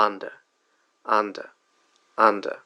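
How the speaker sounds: under with a short open first vowel; example word demo. Shown as a demonstration. Teacher modelling the word under. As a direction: say under slowly and clearly.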